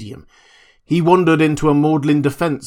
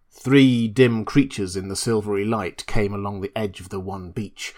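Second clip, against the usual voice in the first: usual speaking voice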